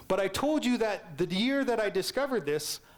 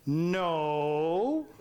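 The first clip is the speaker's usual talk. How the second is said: flat teacher no; 3 semitones lower than usual. Retold or imitated. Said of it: "And he went".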